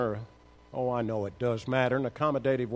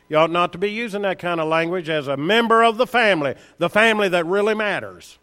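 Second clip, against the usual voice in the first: louder, rising sermon rebuke; preaching at full force; 4 semitones higher, 5 dB louder than usual. Acted. Preacher scolding church members over their language, full force. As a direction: drive it home to the flock, scolding.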